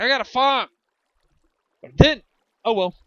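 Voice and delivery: excited yell. Real, not acted. Exclaiming about farming.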